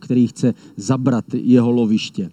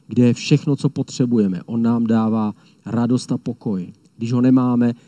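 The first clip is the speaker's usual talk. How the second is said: ordinary talk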